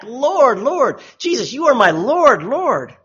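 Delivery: loud, high, religious fervour on every "Lord"; hammed-up emphatic profession of faith; emphatic devotion. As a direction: proclaim "Lord" emphatically, pitch lifted. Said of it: "It's, like, emphatic".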